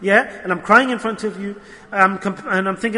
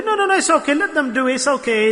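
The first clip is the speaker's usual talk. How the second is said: mock breezy brush-off, pitched way up; mocking dismissive voice; 6 semitones higher than usual. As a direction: imitate them waving it away, high and light. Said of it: "Whenever I ask them that".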